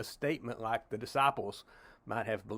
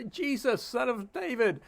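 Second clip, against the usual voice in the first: imitating a woman calling out from a distance, much higher; a hint of a distant plea, pitched up; calling from afar; 10 semitones higher than usual. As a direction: mildly call Jesus from far off. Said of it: "she's kinda shouting from a distance".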